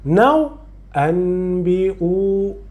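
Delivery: ambiu drawn out slowly, m held in the nose; slow nasal demo. Shown as a demonstration. Teacher slowing down ambiu for the student, drawn out. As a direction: stretch ambiu slowly like a hum. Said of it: "deve alongar pouco a pouco".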